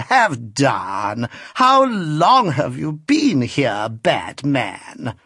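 put-on very slow, coy scolding old lady; mock-scold him, lingering on every word; an old lady's languid, teasing rebuke; affected old lady. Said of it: "said the lady in the chair".

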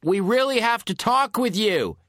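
loud, high call to someone inside; shouting through a door. Acted. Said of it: "I shouted through the door".

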